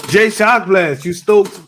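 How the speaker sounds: loud call to the next guest. Real, not acted. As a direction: call out his name loud. Host calls out to guest.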